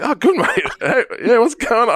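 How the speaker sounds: high, laughing, playing along; forced cheer. Playing along with the stranger, high and laughing. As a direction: fake the friendliness. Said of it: "I'm like".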